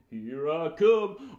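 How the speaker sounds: slow, drawn-out puppet dog answer; Jim Dog. Pretend. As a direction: answer the call as an eager dog. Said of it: "Let's call out old Jim".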